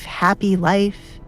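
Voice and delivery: normal talking voice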